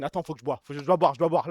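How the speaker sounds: parched and out of breath; dry throat, panting softly; wheezy voice. Real, not acted. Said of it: "Stream essoufflé".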